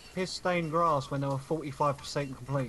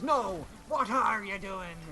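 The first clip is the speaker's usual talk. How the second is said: slow, lightly voiced Barbossa retort; a hint of a slow pirate drawl; 3 semitones higher, slower than usual. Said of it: "Barbossa goes".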